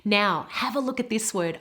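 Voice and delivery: ordinary talk, nothing marked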